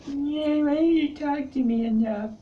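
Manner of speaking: hammed-up needy complaining up high; mock needy whine. Nagging a crush for attention, high mock needy whine. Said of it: "it more came out like".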